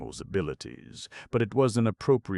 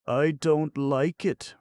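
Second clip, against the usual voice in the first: timid; 12 semitones higher, 8 dB louder, slower than usual. A narrator's reading. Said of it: "rejoined Oliver timidly".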